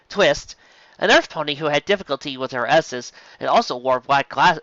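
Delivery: plain reading voice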